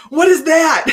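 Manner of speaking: loud, high startled "what is that"; startled question. Retold or imitated. Sam finding crystals in her bra, startled and loud. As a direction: blurt it out like a shocked husband.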